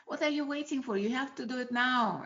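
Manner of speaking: loud, high mock scolding; grandma's yelling. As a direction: yell it like an impatient grandma nagging a child. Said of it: "my grandma used to yell at me".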